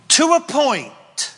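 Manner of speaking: God setting a firm limit, loud; booming God voice. Acted. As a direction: draw the line like a thundering God. Preacher voices God to Lucifer.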